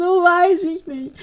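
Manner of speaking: high, slow tearful whine; crying. Retold or imitated. Answering why she's crying, slow high teary whine. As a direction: drag it out through mock tears. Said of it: "Voll am Heulen".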